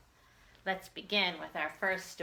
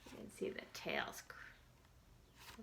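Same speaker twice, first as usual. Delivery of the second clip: breathy whispered aside, a little slow; 16 dB quieter, slower than usual. Real, not acted. Teacher whispers to the children about the crows. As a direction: hush it as if sharing a secret. Aside while showing the book.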